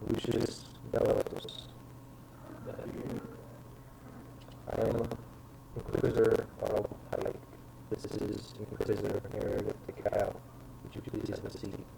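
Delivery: low, soft formal interrogator; lightly voiced inquisitor.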